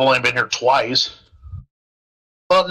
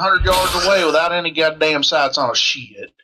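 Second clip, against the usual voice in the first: put-on southern accent; 4 semitones higher, faster than usual. Rifleman brags to squad in southern accent. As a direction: spin a Southern hunting tall tale. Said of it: "southern accent".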